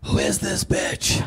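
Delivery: low rasped growl, slow; gruff mock growl. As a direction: growl it like a tough guy sizing someone up. Gruff inner voice, pillow.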